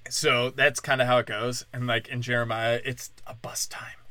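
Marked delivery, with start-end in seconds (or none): 2.8-4.0 whispered aside; whispering a dark aside to listeners. Real, not acted. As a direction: drop to a whisper. Aside about Jeremiah's era.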